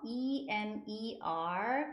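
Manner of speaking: letter by letter, slowly drawn out; slow, separated letters; spelling out. Shown as a demonstration. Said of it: "이렇게 발음하시면 되고".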